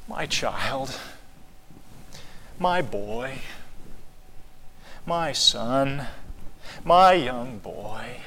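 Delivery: put-on soft, hushed father calling his boy; gentle loving father, slowing at the end; tender endearment. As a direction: hold each phrase like something precious. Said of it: "a preciousness in the tone of voice".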